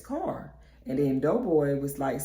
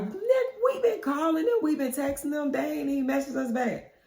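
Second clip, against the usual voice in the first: high-pitched, insistent griping; exasperated complaint; 10 semitones higher than usual. Retold or imitated. Friend griping about unanswered calls and texts, exasperated. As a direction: complain like you've been ignored.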